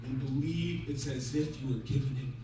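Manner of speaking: low, soft, halting instruction; solemn counsel. Acted. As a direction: give each phrase room, gently and gravely. Wise rabbi gives solemn instruction.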